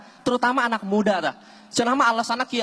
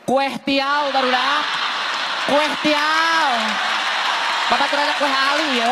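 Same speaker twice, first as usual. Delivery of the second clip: mocking shrill repeat; 5 semitones higher, slower than usual. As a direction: parrot her order to ridicule it. Mocking the girl's order. (niru bari moyok, sora luhur tur tarik)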